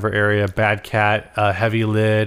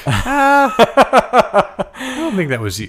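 laughing; 7 semitones higher, 4 dB louder, slower than usual. Real, not acted. Laughing disagreement.

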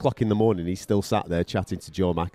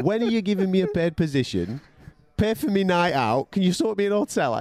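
higher, whining list of demands; mocking the mate; 9 semitones higher than usual. Host mimics the freeloader's endless requests, high and whiny. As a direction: parrot his cheeky asks.

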